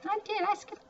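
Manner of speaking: high sheepish I did; high squeak. Real, not acted. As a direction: squeak it out, caught skipping. Admitting own mistake.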